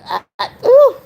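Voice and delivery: high, loud breathless ooh; laughing out. Real, not acted. Still laughing to viewers, catching breath. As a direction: gasp through the tail of a laugh.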